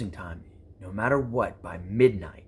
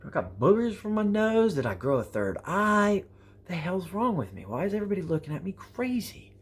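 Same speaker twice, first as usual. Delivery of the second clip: mock bewildered; 9 semitones higher than usual. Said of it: "I'm like".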